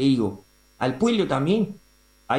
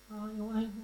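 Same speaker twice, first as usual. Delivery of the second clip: much softer, resigned aside; soft resigned sigh; 5 semitones higher, 14 dB quieter than usual. Acted. Editorialist giving in to the heckler, much softer. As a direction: let it out under the breath.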